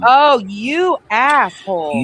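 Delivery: drawn-out, loud mock insult; mock outrage. Co-host teases her partner with loud, slow mock outrage. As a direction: hit him with fake indignation, slow and loud.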